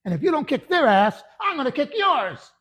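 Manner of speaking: loud, high-pitched drill sergeant bellow; imitated sergeant's threat. Retold sergeant threatens his recruits.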